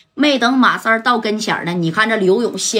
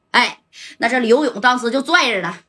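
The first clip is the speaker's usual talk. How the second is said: ordinary delivery, nothing marked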